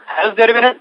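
breaking up on a bad phone line; choppy, glitching call audio; glitchy robotic call. Real, not acted. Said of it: "your audio is breaking".

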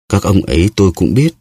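usual speaking voice

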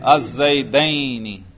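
"الزیدین" slowly as az-zay-day-ni; accusative dual ending. Shown as a demonstration. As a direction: draw out the -ayni of "الزیدین". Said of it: "اگر یہ منصوب ہوتا تو کیسے پڑھتے". (حالتِ نصب میں "الزیدین"، یا کھینچ کر)

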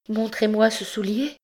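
high-pitched urgent request; start, then ask urgently; sudden high, agitated demand; startled. A narrator's reading. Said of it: "dit l'Égyptienne en tressaillant".

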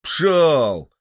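резкий громкий окрик; гони собаку окриком; прогоняют пса. Acted. (громкий окрик на пса)

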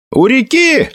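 very high, short shout; yelled reply. A narrator's reading. Felix answers the horseman in the dark, yelling. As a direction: yell the answer back. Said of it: "заорал в ответ Феликс".

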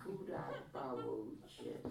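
slow pity with a chuckle; laughing pity. A narrator's reading. (soucit s tichým smíchem)

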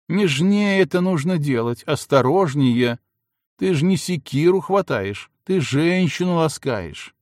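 put-on high, slowed, coaxing young woman; coaxing girl. A narrator's reading. Милана teaches Федька to be gentle, high and coaxing. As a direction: teach him tenderly, voice lifted. Girl instructs clumsy lover.

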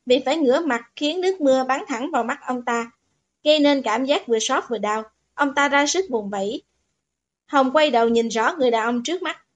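ordinary delivery

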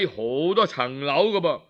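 plain reading voice

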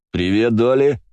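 громко и выше, весело; радостно крикнуть подруге; радостное приветствие. Acted. Жизнерадостный Слава здоровается. (жизнерадостно, громко)

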